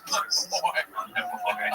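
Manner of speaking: giggling echo of a film line; laughing mock quote. Friend laughing while quoting the film line back. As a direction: chuckle through the movie line.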